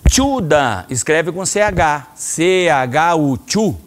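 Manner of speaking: slow letter-by-letter "C H U, chu"; spelling out chu. Shown as a demonstration. Sensei showing the class how "chu" is written, slowly. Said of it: "O C H em japonês tem som de".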